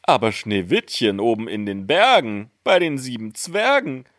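high, measured otherworldly voice; magic mirror. A narrator's reading. Mirror answers the queen, high incanting voice. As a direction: recite the verse high and deliberate. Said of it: "Da antwortete der Spiegel".

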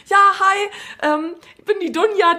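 high-pitched, chirpy greeting; imitated perky hello, easing down; bubbly self-introduction. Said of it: "weißt ja, wie ich bin".

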